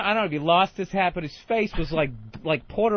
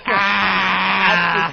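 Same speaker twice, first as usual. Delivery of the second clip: imitated clown laugh; 6 dB louder than usual. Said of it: "you just had that".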